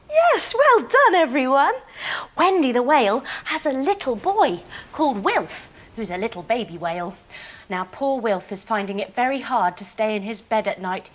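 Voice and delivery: normal talking voice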